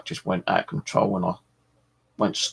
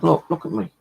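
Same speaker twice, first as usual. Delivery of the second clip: retold exclamation; 9 semitones higher, 4 dB louder than usual. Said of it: "I said".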